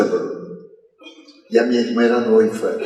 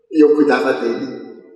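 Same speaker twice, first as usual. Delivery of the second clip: ordinary delivery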